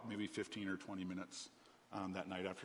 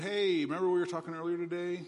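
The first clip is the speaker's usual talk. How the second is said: overly bright, high and loud fake-casual greeting; forced casual tone; 9 semitones higher, 11 dB louder than usual. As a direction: act breezy at three in the morning, trying too hard. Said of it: "I'm trying to talk as a normal conversation".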